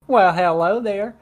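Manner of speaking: a hint of a sweet candy-land voice; Candyland person. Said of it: "how I imagine people in Candyland talk".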